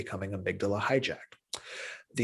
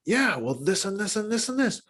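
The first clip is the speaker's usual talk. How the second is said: higher, bright curious listener voice, leaning in; eager empathic listener; 10 semitones higher than usual. Shown as a demonstration. Demonstrating to students an eager, higher listening voice. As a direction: play the friend who really wants to understand. Voicing the calming partner's reply.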